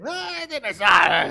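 drawn-out, hammed-up cry of outrage; long wailing mock gripe; mock complaining shout. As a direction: bellow like an exasperated spouse at dinner. Acted spouse griping about saltless dinner.

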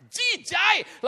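high, loud cry of "jijai"; shouted, hammed-up revulsion on "jijai"; retold disgust. Repeats the disgust at full force.

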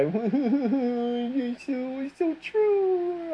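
high, drawn-out mocking whine. Ranting vlogger mocks woke people, high mocking whine. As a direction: sneer it like a parody of them.

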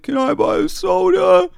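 very high, slow put-on voice; caricatured pleading squeal; homeless man impression. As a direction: mimic a spaced-out man, loud and drawn out. Imitating the homeless man asking.

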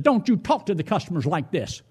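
ordinary delivery

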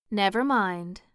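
ordinary delivery

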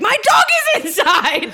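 high, loud hammed-up British lady; crazy British lady. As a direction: shriek it as a frantic British caricature. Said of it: "this crazy British lady shows up".

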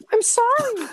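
put-on high falsetto girl voice; man doing a woman's voice. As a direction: squeak it out like a young girl. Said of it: "gonna try to do some, like, voice acting here".

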